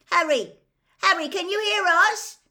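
loud, high call through a wall; high-pitched yell for attention. A narrator's reading. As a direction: shout to be heard on the other side. Calling through the boarded fireplace.

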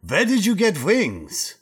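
lightly put-on Russian accent, asking. The Russian prisoner asks Sam about his wings, lightly Russian accent. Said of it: "my Russian accent".

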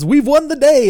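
high, loud mock-heroic proclamation; cheesy victory cry. Host imitates Henry's cheesy line, high and loud. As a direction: overplay a corny triumphant lord. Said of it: "the way he says it".

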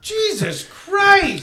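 very high, drawn-out exclamation; mock shock. Guest reacting to the fat jab, mock shock. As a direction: squeal it slowly in mock disbelief.

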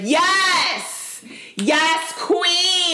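high, loud mock cheering; hammed-up hype cheer; cheering supporters. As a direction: gush like an adoring fan. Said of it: "her supporters were like".

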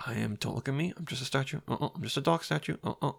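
very soft, hushed plea not to be seen; dog posing as scenery, hushed; hushed pretend statue. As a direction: whisper like a frozen statue. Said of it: "There's Pose as Scenery".

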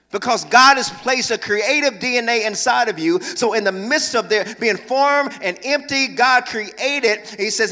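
loud, rising sermon climax; preaching at full force. Acted. Pastor urging the church to create, preaching loud. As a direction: drive it home to the congregation.

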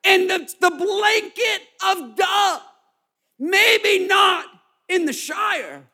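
high, loud preacher reading scripture, slowed; preaching at full force. Acted. Pastor preaches the verses to the church at full force.

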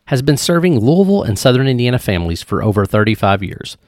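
loud, upbeat podcast ad read; sponsor ad read. Acted. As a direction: sell it like a radio spot. Host reads sponsor ad.

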